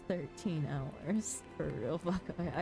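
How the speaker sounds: ordinary delivery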